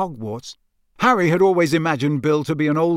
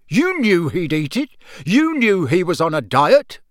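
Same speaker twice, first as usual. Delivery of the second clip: higher, louder furious father; an angry dad, louder on the last words; roaring; 4 semitones higher, 5 dB louder than usual. A narrator's reading. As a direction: hammer the accusation home. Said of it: "roared Mister Weasley".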